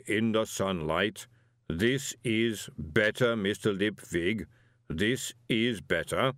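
slow, ponderous golem voice; placid golem. Acted. Golem explaining his years as a pump, placid and slow. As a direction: weigh out every word evenly and slowly.

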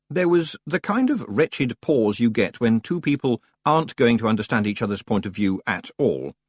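usual speaking voice

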